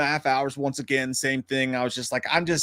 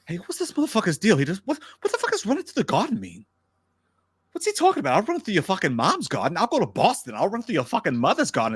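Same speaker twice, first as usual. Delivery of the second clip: higher, agitated tough-guy impression; Wahlberg; 5 semitones higher than usual. Co-host baffled by the garden order in the subway line, indignant Wahlberg impression. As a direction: play an indignant, trash-talking Boston tough guy. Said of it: "Could I get a Wahlberg".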